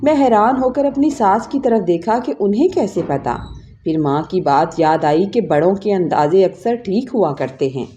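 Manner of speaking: ordinary delivery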